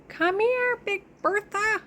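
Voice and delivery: high, sing-song voice for the cat; pet talk. Real, not acted. Calling her cat Bertha over in high pet talk.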